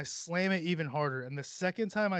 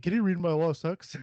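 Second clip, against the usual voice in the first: raised voice, talking at a phone; loud voice command; 2 semitones higher, 7 dB louder than usual. Retold or imitated. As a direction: bark a request at the voice assistant. Imitates himself talking to Siri.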